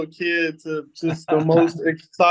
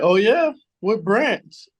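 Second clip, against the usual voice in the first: raised-pitch, interested questioning; curious officer; 5 semitones higher, 3 dB louder, slower than usual. Retold or imitated. Officer to young driver who got drafted, curious. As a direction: lightly imitate an officer perking up at news.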